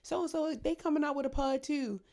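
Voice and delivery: high, sly gossip spilling tea; messy gossip voice. Retold or imitated. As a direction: pitch up like a nosy gossip. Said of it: "So, like".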